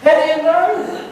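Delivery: high-pitched, agitated retort, imitated; worked-up challenge. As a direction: demand an answer like a rattled fan. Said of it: "she's getting all worked up".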